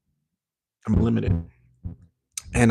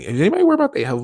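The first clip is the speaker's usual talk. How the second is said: catchphrase mimicry; 7 semitones higher, 4 dB louder, much faster than usual.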